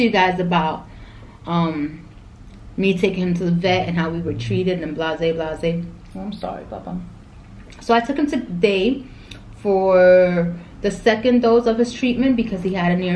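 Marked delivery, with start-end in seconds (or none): 6.1-7.0 low, hushed sweet talk; soft apology to pet. Real, not acted. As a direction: murmur sorry to the dog. Apologizing softly to her dog.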